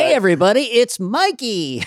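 hammed-up, high-pitched show intro; kids' sitcom intro. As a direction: announce yourself like a kids' show star.

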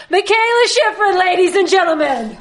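high, loud ringside announcer; announcer introduction. Pretend. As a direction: present the star to a cheering crowd. Host introduces guest like an announcer.

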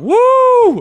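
high, loud mock woo; whooping like an excited crowd. Host asks if the class was whooping, mock woo.

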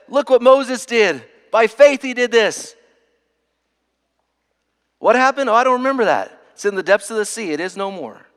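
high, loud, emphatic preacher voicing God; God exulting. Preacher as God who forgets Moses' sin, high emphatic proclamation. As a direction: proclaim it high and full, easing off at the end.

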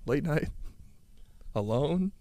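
"Late night. Alone." said softly, hushed, almost whispered at the end; hushed storytelling. Acted. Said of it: "I was thinking about being a father".

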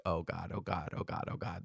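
low, very quiet string of mock dread; hushed, overwhelmed aside to yourself; muttered dismay. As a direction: mutter it under your breath. Muttered dismay at elaborate lunches.

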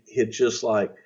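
ordinary talk

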